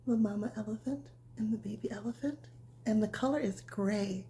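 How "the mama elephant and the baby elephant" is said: ordinary delivery, nothing marked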